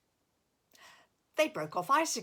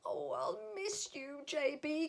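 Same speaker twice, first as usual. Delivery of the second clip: high, soft little voice calling; calling softly; 8 semitones higher, 6 dB quieter than usual. Acted. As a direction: call after him softly. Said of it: "called Figgy".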